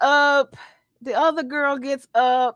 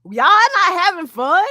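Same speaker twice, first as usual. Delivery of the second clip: put-on angry girl; 6 semitones higher, 8 dB louder than usual. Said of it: "Angry girl was like".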